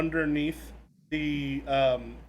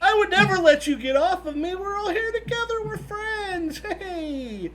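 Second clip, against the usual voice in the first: much higher, sugary robot voice; clingy AI voice; 13 semitones higher, 5 dB louder, slower than usual. Acted. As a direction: coo like a needy machine. Clingy elevator AI voice.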